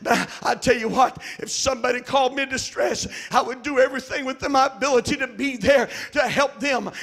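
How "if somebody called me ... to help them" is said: full-force pulpit preaching; fiery preaching. Acted. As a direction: hammer the congregation with conviction.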